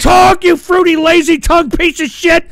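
loud, high yell of talk; furious demanding shout; screaming at caller. Real, not acted. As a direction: bark the word talk at him.

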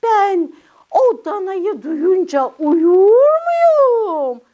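high, wailing little character voice; hammed-up high puppet complaint, vowels drawn out; alarmed puppet child. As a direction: overplay the fright in a puppet voice. Puppet dramatises fear of calf.

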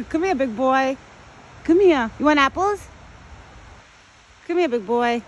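calling an animal. Real, not acted. Woman offers her buck apples, calling out. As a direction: coax a big pet over from a distance.

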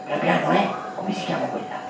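normal talking voice

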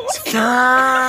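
sudden loud burst of laughter. Real, not acted.

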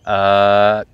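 "uh" held low, closed by a K; tongue-position demo. Shown as a demonstration. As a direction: raise the back of the tongue to end "uh" on K. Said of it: "back part of my tongue is raising".

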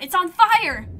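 loud, high cry of alarm; panicked shout. A narrator's reading. Colonist warning the crowd, panicked shout. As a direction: yell the warning at full pitch. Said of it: "they shout".

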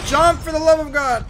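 loud, high exasperated yell; frustrated shout. Real, not acted. Character won't climb, frustrated.

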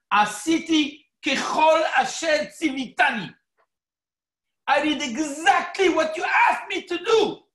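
loud, high, self-satisfied declaration to God; a smug worshipper boasting loudly to God; proud shouting boast. Acted. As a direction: shout your good deeds up to heaven proudly. Boastful confession acted to God.

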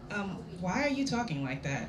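soft bewildered question through a giggle; asked half laughing, almost under the breath; quiet puzzled laugh. Real, not acted. Puzzled man, laughing reader.

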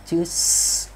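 "S" held as a long hiss; voiceless S. Shown as a demonstration. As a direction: draw "S" out with no voice. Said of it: "là vô thanh".

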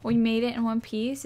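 normal talking voice

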